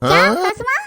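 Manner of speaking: loud, caught-off-guard what; mother-in-law startled by the grandmother's warning, loud; startled question. Acted. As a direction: blurt it out in surprise.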